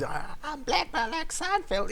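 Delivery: high put-on comic voice, squeaky all through; Chris Rock impression. Quoting Chris Rock on liking Seinfeld, squeaky high voice. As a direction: lift into a squeaky comedian voice. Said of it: "he goes".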